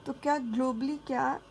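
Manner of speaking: ordinary talk, nothing marked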